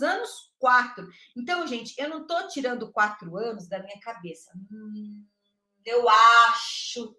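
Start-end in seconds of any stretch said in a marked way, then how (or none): 5.8-7.1 louder and very slow "eu acho"; mocking "acho". Shown as a demonstration. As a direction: drag "eu acho" out to mock guessing. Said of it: "tirem esta palavra acho".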